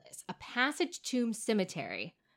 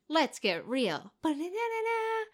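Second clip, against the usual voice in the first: show intro; 5 semitones higher than usual. Shown as a demonstration. Pitching the show opener to her cohost, announcer style. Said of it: "How would we open that show?".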